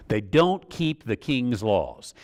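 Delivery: ordinary talk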